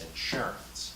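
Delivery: insurance as in-SUR-ance; second-syllable stress on insurance. Shown as a demonstration. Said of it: "Second syllable".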